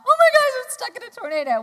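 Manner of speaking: high, loud, fast panicked squeal; mock panic. Retelling own panic in the storm.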